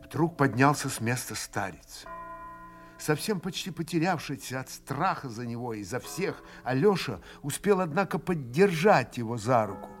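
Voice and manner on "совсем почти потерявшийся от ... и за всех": ordinary delivery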